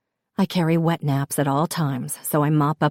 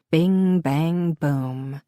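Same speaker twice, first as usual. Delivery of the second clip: ordinary delivery, nothing marked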